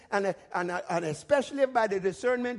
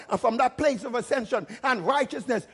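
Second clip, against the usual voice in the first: ordinary talk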